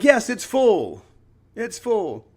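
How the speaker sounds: high, drawn-out shout; slow, high, rousing exclamation; triumphant professor. Retold or imitated. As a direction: announce it big to a cheering class. Professor acted answering shouting class.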